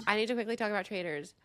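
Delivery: ordinary delivery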